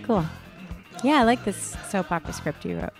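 ordinary delivery

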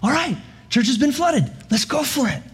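rally everyone cheerfully to get to work; joyful church. Pretend. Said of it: "with just great joy".

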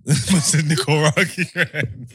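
drawn-out, giggling disbelief at the word; laughing question. Real, not acted. Mocking a mate's heritage, laughing in disbelief.

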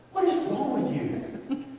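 high, slow, incredulous question; acted exasperation. Embarrassed husband to dancing wife, mock exasperation. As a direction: draw it out high, baffled. Acts bafflement at wife's dancing.

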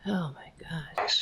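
a breathless, voiceless "oh my God"; stunned whisper. Real, not acted. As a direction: whisper it as if you can't believe what you just heard.

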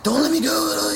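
high, strained cry of panic; panicked plea. Retold or imitated. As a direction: plead as if passing out. Retold panic attack plea.

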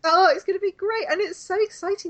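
bright, high-pitched enthusiasm; excited. Real, not acted. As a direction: gush about it, pitch way up. Mock-enthusiastic opener.

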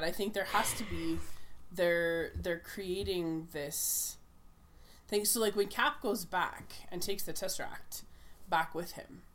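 usual speaking voice

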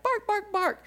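put-on very high little girl barks; squeaky kid barking; child barking. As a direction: daughter barking from back seat, very high. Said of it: "She's in the back".

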